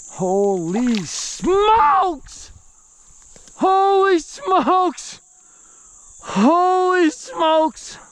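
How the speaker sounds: overjoyed, drawing each word out; real excited shout. Real, not acted. Landing a huge bass.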